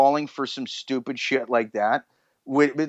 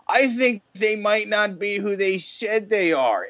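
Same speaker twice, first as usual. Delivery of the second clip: slow, higher-pitched mocking quote; mock earnest realization; 7 semitones higher, slower than usual. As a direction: drag it out high, mimicking the show's hosts. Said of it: "And then they're like".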